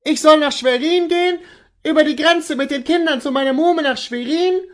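high, loud, incredulous wife; horrified cry. Acted. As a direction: cry out, aghast at being sent away. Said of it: "rief die Hausfrau".

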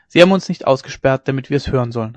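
ordinary delivery

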